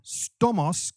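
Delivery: ordinary delivery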